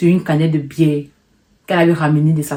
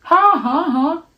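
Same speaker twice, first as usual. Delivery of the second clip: high, short laugh; nervous laugh; 11 semitones higher than usual. Real, not acted. Narrator laughing at the knife threat, high nervous laugh.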